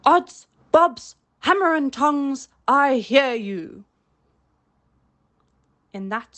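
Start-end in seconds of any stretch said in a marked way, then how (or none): 0.0-3.8 put-on boy doing a loud, slow pirate-captain voice; imitating Hook. A narrator's reading. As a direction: mimic the captain to fool him. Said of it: "answered in Hook's voice".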